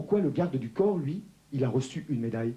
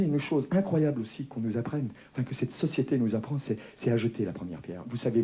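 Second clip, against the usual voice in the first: usual speaking voice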